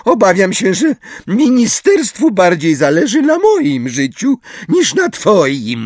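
very high, loud saccharine voice; sugary sweet. A narrator's reading. Umbridge explains to Harry, sugary sweet. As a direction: coat the put-down in sweetness. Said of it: "słodkim głosem szturchając go".

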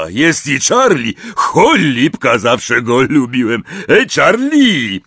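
a big friendly giant reminiscing; Hagrid's voice. A narrator's reading. Hagrid to Harry, fondly remembering.

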